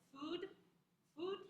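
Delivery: "food" cut off on a stopped D. Shown as a demonstration. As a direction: stop the sound at the end of "food". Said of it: "telling you to stop it".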